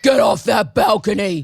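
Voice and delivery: a hint of a gruff tough guy. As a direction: bark it like a bouncer, lightly. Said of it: "your inner world voice".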